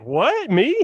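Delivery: high, incredulous imitation; hammed-up innocent disbelief; mock shocked. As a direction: act stunned to be singled out. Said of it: "He's just so shocked".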